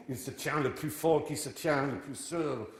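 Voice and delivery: slow, declaimed French line; French quotation. Shown as a demonstration. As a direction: recite it like theatre in French. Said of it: "I don't know why I said that in French".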